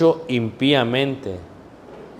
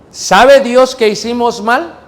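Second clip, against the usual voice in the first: high, loud, slow challenge; fiery preacher's question; preaching at full force; 9 semitones higher, 6 dB louder than usual. Real, not acted. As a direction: hurl the question at the congregation. Preacher poses rhetorical question.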